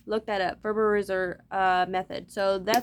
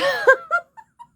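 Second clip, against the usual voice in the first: high, loud cackling laugh; burst of laughter; 9 dB louder than usual. Real, not acted. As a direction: crack up at your own dark joke. Laughs at own joke.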